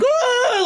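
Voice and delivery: short high yelp of fright; mock the kids' scream as the car lurches; imitating a sudden frightened cry; scared scream. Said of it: "all of us in the car were like".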